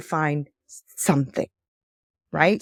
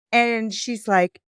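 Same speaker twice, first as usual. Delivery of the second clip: usual speaking voice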